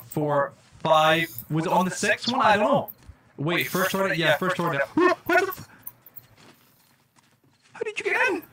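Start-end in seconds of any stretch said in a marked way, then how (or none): 4.9-5.7 high, shocked gasp cut off; startled gasp. Real, not acted. Mob breaks in. 7.7-8.4 high, disbelieving squeak; shocked. Real, not acted. Mob inside locked base.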